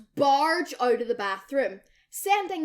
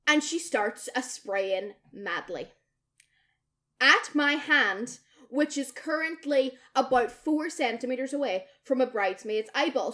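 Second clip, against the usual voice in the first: ordinary delivery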